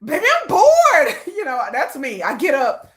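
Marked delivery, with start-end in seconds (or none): 0.0-1.2 high whiny bored. Retold or imitated. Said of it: "He's like".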